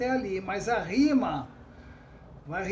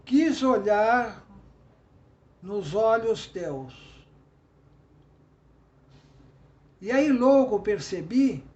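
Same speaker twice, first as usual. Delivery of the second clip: slow recited verse, drawn-out words; poem recitation; slower than usual. Shown as a demonstration. Teacher reciting a sample love verse to students, slow and recited. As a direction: recite the love quatrain slowly and tenderly. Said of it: "mas vou falar agora".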